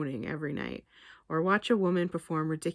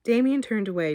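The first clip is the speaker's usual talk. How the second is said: usual speaking voice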